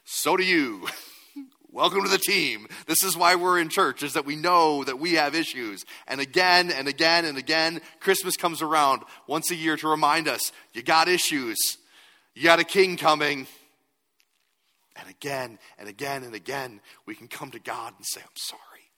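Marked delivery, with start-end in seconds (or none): 0.0-5.8 loud, high, fired-up preaching; full-force preaching. Acted. 6.0-7.8 "again" repeated loud and high; loud refrain. Shown as a demonstration. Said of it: "repeated refrain". 8.0-13.5 loud, high, fired-up preaching; full-force preaching. Acted. 18.2-18.9 whispered apology. Pretend. Confessing to God.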